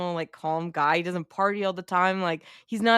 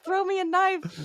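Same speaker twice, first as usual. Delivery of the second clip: much higher strained call for the knife; mock urgent call; 12 semitones higher than usual. Jay to Jonah during the struggle, a much higher urgent call. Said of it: "he's like".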